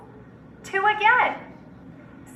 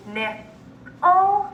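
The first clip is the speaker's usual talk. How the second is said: Nickel in two parts, second higher; syllable by syllable; 5 semitones higher than usual. Shown as a demonstration. Teacher repeating Nickel for students, syllable by syllable. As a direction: split Nickel, lifting the last syllable. Syllable blending drill, word broken up.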